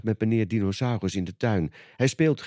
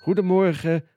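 higher, louder cheerful shopkeeper; beaming greeting; 7 semitones higher, 4 dB louder than usual. A narrator's reading. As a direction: greet the customers with a big beam. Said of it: "straalt meneer Vos".

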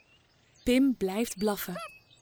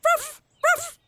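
high, louder yappy woof; playful puppy barks; dog bark; 4 dB louder than usual. A narrator's reading. Dog barking in story.

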